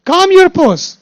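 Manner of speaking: Calm your pose called out high and loud; emphatic mantra. Shown as a demonstration. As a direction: urge it forcefully, pitch up. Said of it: "Why you are so agitated".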